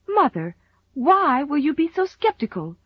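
high-pitched, wounded demand; reproachful. A narrator's reading. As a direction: reproach Mother for doubting you. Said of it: "she demanded reproachfully".

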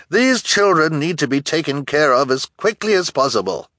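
high, urgent demand; indignant woman, pitched well up; insistent townswoman. A narrator's reading. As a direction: press the crowd to act. Townswoman urges the mob on.

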